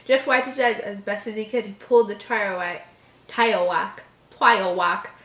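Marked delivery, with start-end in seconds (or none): none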